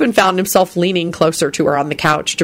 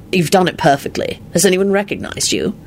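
serious; 2 semitones higher than usual. A narrator's reading. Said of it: "he asked her seriously".

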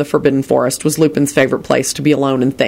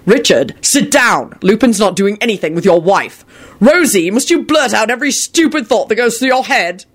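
raised, emphatic telling-off; exasperated scolding; 6 semitones higher than usual. A narrator's reading. Quentin restoring order at breakfast, exasperated. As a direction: scold them one after another.

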